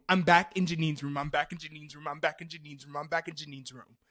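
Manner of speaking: run-on repeats tailing off from loud; rapid looping repetition. Retold or imitated. Storyteller stuck in the room loop, repeating mechanically. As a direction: rattle it off on a loop, settling down. Same line looped over and over.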